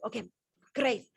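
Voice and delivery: ordinary talk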